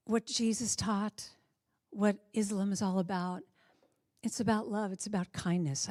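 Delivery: normal talking voice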